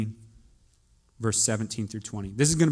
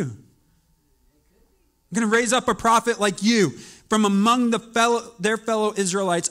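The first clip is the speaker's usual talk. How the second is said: scripture reading; 5 semitones higher than usual. Acted. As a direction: proclaim the verses with weight. Reads scripture aloud.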